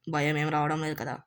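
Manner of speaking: ordinary talk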